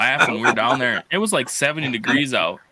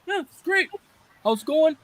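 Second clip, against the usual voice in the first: much higher, cheerful pretend gator; gator's happy voice. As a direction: voice the gator as a contented pet, way up high. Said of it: "She was like".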